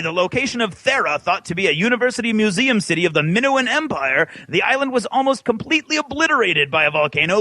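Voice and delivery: ordinary delivery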